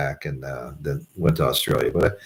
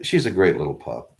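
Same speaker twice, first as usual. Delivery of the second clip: ordinary talk, nothing marked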